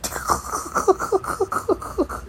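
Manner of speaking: very high airless wheezing laugh; silent wheeze laugh. Real, not acted. Cracking up at his own crude line, airless wheeze.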